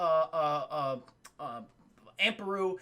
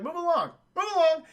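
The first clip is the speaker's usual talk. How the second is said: commanding mind trick; 8 semitones higher, 6 dB louder than usual. Retold or imitated. Ben's Jedi mind trick.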